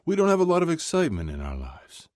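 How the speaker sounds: a dad ribbing his son at breakfast; father's voice. A narrator's reading.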